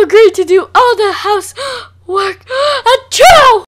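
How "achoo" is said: loud sneeze. Acted.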